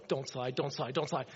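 tense pleading. Retold or imitated. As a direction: beg the van not to slide, quietly. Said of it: "I'm going".

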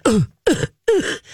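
high-pitched imitation grunts of a thumped dog; short mock grunts, as if being thumped; pounded dog's grunts. Host playing a dog being pounded on, mock grunting. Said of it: "see the dog going".

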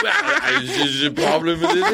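a light Hugh Grant impression with laughs. Hugh Grant caught smoking, mock flustered. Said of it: "he was like".

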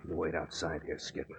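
hushed aside. Acted. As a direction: keep it down so the man inside can't hear. Aside to partner before trap.

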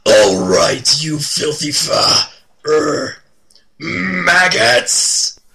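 yelling drill sergeant bark. Acted. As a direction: bark it at raw recruits. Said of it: "Yells Drill Sergeant Nasty".

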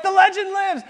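high, loud gleeful cry; triumphant cheer. Retold or imitated. Pranksters celebrating the cabin's scream, gleeful cheer. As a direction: crow it in triumph.

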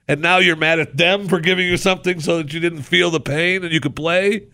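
high, loud sarcastic scolding; mock scolding. Scolding players who sued the NFL, high and loud. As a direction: scold them like a fed-up parent. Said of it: "scolding NFL players".

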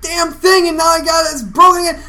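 high, loud, strained yelling; a fed-up father's shouting rant; dad's furious rant. Retold or imitated. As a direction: rage at the broken game again. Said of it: "He's like".

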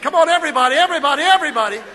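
rally everybody to raise a hand; urging the crowd. Real, not acted. Pastor coaxing the congregation to raise hands, loud and quick.

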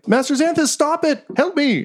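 very high-pitched robotic panic; freaking-out little robot. Acted. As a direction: squeal for rescue as a panicking robot. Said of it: "it starts freaking out".

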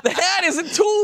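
high, laughing insistence; exasperated. Real, not acted. Fan laughing at friend's plot mix-up, high insistence.

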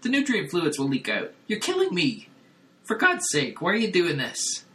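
high, frantic and pleading; panicked brain voice. Retold or imitated. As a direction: squeal it out in horror. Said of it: "And Cliff's like".

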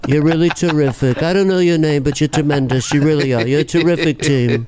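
flattering Trump mumble; Trump impression. Trump flattering the crowd, in his voice. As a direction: gush praise in a Trump voice.

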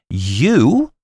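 high, loud indignant goblin yelp. Acted. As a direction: spit it out, choking with indignation. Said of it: "indignation choked his utterance".

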